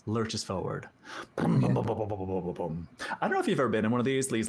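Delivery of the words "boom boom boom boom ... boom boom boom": engine noise imitation.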